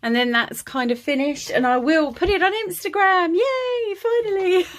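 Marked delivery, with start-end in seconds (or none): 1.5-4.7 high, bright cheer with a laugh; excited. Real, not acted. Journal finally finished, cheering.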